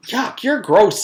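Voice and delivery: high, loud yuck; girl grossed out by Paul, high and loud. Acted. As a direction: recoil in disgust. Leslie disgusted.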